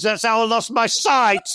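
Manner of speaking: put-on punchline declaimed in a northern accent. Poet ending his mock-nostalgic bonfire poem, northern accent. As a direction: cap the verse in broad northern English. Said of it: "do it in a northern accent".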